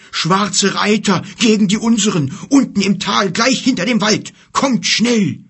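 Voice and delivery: loud, high, urgent bear; urgent call. Acted. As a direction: rush them to come. Bear urges them to hurry.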